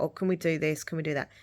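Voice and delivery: normal talking voice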